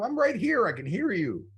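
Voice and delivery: squeaky high indignant reply; protest, voice jumping up; indignant retort. Retold or imitated. Kirk protesting to the guest who insulted him, high indignant squeak.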